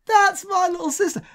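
high-pitched, thrilled squeal of recognition; excited exclamation. Retold or imitated. Brother spots his sister on TV, excited squeal. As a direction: blurt it out high, astonished and delighted. Retells spotting sister on television.

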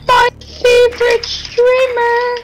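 put-on high, slow whiny child begging; whiny kid voice. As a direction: beg like a little kid.

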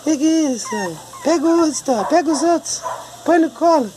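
very high cutesy voice. Real, not acted. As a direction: coo short phrases way up high. Baby talk around the puppies. (voz fininha de falar com bichinho)

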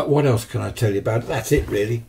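usual speaking voice

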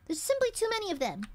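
ordinary talk, nothing marked

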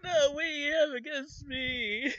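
very high, loud anguished whine; wailing geek. Retold or imitated. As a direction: victim shrieking at Spike. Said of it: "The guy's like".

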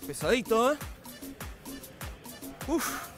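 normal talking voice

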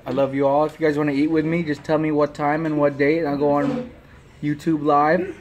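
food at the lips; about to take a bite; talking while eating. Real, not acted. Seen 3: Talks holding green vegetable on chopsticks at lips, bites near end.

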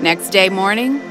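plain reading voice

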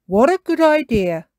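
lightly voiced approving father figure; Daddy Pig, approving. A narrator's reading. Daddy Pig praises the idea.

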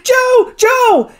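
very high, loud cry of horror; horrified high call after a falling friend; horror-stricken. A narrator's reading. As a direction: scream the name in horror. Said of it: "exclaimed the doctor, horror-stricken".

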